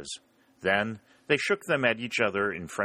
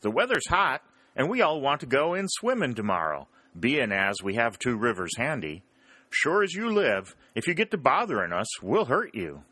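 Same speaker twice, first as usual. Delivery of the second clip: higher voiced Southern soldier, playful warning; mock threat; 5 semitones higher than usual. Mississippian warning the Yankees off in mock threat, higher. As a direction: threaten them in jest.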